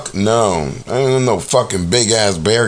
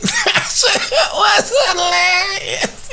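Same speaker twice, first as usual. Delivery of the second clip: high, slow laugh through the words; laughing hard; slower than usual. Real, not acted. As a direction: crack up while talking. Laughing at the clip.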